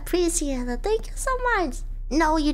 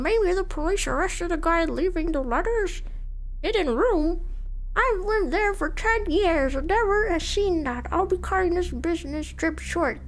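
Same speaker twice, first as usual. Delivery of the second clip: grandpa voice. Acted. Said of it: "I don't want to do the grandpa voice anymore".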